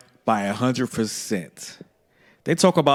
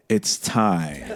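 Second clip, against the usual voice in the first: lower, softer death-row announcement; grim warden; 6 semitones lower, 4 dB quieter than usual. Retold or imitated. Mother summoning her kid to the cry room, grim warden. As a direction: announce the sentence like a prison warden. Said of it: "she turns into the warden, like".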